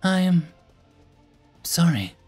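high, halting small voice; meek, broken-up confession; downcast apology. A narrator's reading. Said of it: "she said, looking downcast".

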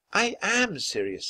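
high, slow protest; voice rising. A narrator's reading. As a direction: insist with your pitch climbing. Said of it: "her voice rising".

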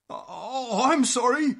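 high, flustered apology; cowed storybook dragon; frightened splutter. Acted. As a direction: splutter it nervously as a scared dragon. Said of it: "he spluttered".

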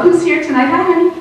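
normal talking voice

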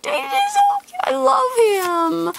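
very high squeal of delight; squealing over cute. Real, not acted. Fawning over a cute Pokemon card, high squeal. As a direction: squeal like it's the cutest thing ever.

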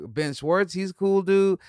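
ordinary talk, nothing marked

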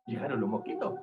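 low, soft storyteller aside; hushed announcement. Acted. Storyteller hearing mosquitoes arrive at the pond, hushed. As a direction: say it low and quiet, as a first warning.

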